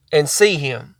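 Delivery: ordinary talk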